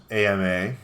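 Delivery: ordinary talk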